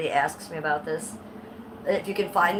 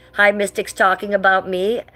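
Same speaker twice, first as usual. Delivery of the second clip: ordinary talk, nothing marked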